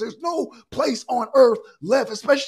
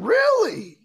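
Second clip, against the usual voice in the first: incredulous really; 10 semitones higher, 3 dB louder than usual. Real, not acted. Incredulous retort mid argument.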